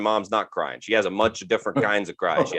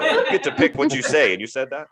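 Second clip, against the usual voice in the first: usual speaking voice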